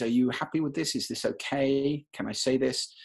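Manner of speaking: rising, permission-seeking questions; deferential, seeking approval. Acted. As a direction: ask tentatively for approval. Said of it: "slightly deferential way to speak".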